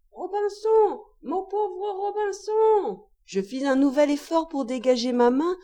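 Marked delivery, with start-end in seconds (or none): 0.1-3.0 very high, slow plaintive parrot wail; very strident, tearful parrot. A narrator's reading. Forgotten parrot pleads for its master, very strident and tearful. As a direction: hold each name high and piercing, very drawn out. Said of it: "plus stridente encore et plus éplorée".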